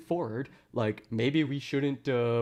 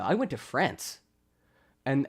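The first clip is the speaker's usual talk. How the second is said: ordinary talk, nothing marked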